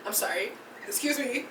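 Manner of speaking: ordinary delivery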